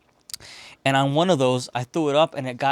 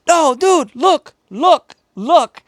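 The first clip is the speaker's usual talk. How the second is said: very high, loud desperate yelling; frantic plea; 14 semitones higher, 11 dB louder, much slower than usual. Retold or imitated. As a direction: beg him to look before the door shuts. Mikey pleading at closing door.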